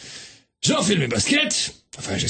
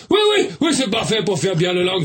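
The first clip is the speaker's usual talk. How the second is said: normal talking voice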